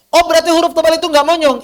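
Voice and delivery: high, quick questioning voice; learner's question. Acted. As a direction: ask it fast and high, like a puzzled learner.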